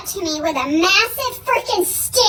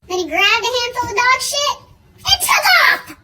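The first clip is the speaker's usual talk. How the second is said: normal talking voice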